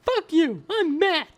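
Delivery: high squeaky furious nerd; angry nerd voice. Pretend. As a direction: snap it out like a raging nerd. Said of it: "angry nerd voice".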